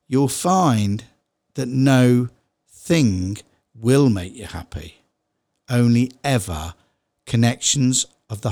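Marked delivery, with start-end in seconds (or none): 1.7-5.0 no pause thing, split into two words; no thing split apart. Shown as a demonstration. Teacher splitting nothing into no thing for viewers. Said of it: "separate the N and the O from the thing".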